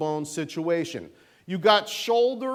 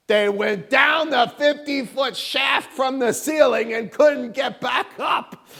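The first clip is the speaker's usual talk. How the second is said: sarcastic retort; 7 semitones higher, 6 dB louder than usual. Real, not acted. Mocking alternative to burial theory.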